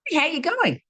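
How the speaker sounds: bright Aussie "Hey, how you going?" pitched well up; Aussie greeting. Retold or imitated. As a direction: sing out a friendly Australian hello. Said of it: "Australians got this saying".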